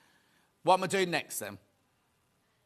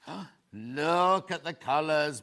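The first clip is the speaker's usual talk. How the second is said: ordinary talk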